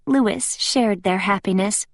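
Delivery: usual speaking voice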